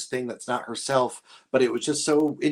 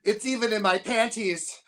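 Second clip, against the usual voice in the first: laughing quote; 7 semitones higher than usual. Retold or imitated. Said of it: "I love when she goes".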